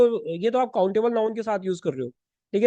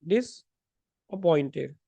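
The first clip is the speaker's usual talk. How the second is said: slow; 4 semitones lower, much slower than usual. Shown as a demonstration. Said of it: "इसका मतलब हो गया".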